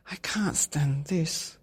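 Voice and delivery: slow, drawn-out complaint; weary complaint. Acted. Tired Daddy Bear complaining to himself, slow weary voice. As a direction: drag the words, worn out. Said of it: "said Daddy Bear".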